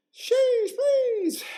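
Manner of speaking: high, loud drawn-out squeal; piercing mock plea; high exclamation. Sudden high outburst amid chat.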